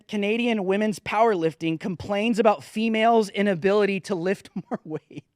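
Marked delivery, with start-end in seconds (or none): 4.0-5.2 soft whispered words with a giggle; laughing whisper. Real, not acted. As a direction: whisper it while stifling a laugh.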